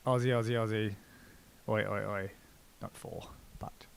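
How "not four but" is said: near-whispered, very soft; hushed aside. Real, not acted. Host correcting the fighter's chant, in a hushed aside. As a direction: drop to a slow whisper.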